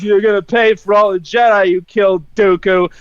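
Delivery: low mock-heroic threat; retold movie threat, pitched low; Anakin. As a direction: drop the pitch for a deadpan vow. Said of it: "and Anakin says".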